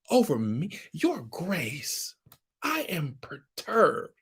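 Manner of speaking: high, wounded, slow "how dare you" voice. Acted. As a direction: play the shocked innocent victim, clutching pearls.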